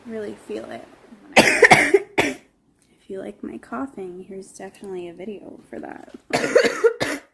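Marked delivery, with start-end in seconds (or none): 0.9-2.4 big harsh cough; loud hacking cough. Acted. 6.3-7.2 harsh cough. Acted. Cough video, loud cough.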